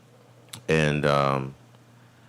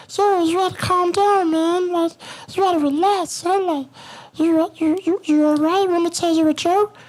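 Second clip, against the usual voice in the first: very high coaxing inner voice; high calming voice. Retold or imitated. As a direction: soothe yourself in a squeaky high voice. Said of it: "my voice that I use to calm myself down".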